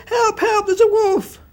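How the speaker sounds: high, loud frantic cry; desperate plea. A narrator's reading. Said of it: "he said".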